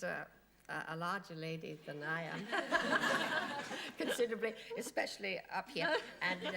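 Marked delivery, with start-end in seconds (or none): 2.5-3.9 high real laugh; loud laughing peal; burst of laughter. Real, not acted. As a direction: laugh at yourself. Laughing at her own story.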